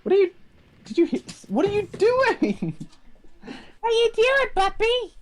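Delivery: very high cooing pet voice; puppy talk. Real, not acted. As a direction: squeal it sweetly at your puppy.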